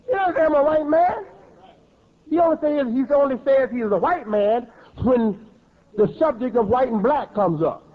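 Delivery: usual speaking voice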